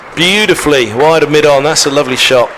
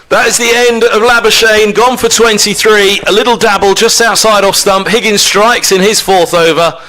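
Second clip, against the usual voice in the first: cricket commentator; 6 semitones higher than usual. Real, not acted. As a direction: rise up to call the wicket. Wicket falls on live commentary.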